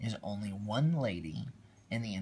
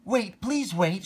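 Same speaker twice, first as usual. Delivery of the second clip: higher, louder and slow call; 10 semitones higher, 9 dB louder, slower than usual. Acted. Cinderella calling to the Grand Duke to wait, higher and louder. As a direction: call after him to stop. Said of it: "she called to the Grand Duke".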